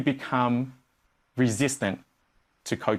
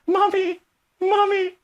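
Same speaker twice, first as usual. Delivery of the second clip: put-on high, slow childish sobbing whine; hammed-up crying over one like; mock crying; 12 semitones higher than usual. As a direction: wail for Mummy like a toddler. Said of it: "Why am I crying to Mummy for?".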